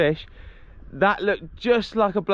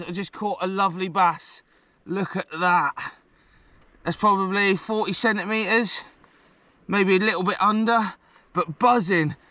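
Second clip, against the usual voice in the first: ordinary delivery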